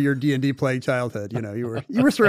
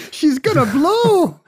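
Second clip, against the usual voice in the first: high, slow, louder mock Scottish warning; Star Trek Scottish; 12 semitones higher, 6 dB louder, slower than usual. Cohost joins the Star Trek bit in Scottish accent. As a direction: drag it out like a panicked Scottish engineer. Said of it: "it was Star Trek".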